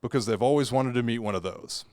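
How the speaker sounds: usual speaking voice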